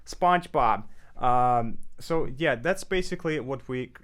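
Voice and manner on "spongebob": whiny closed voice, higher and louder; SpongeBob. Shown as a demonstration. Clarinet teacher showing non-opera singers' tone, whiny SpongeBob voice. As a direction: tighten up into a whiny cartoon voice. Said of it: "whiny, kind of like closed voice".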